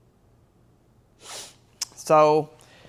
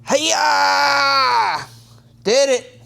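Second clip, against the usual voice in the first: triumphant shout; 8 semitones higher, much slower than usual. Real, not acted. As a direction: yell it as the tool catches. Tool finally fits.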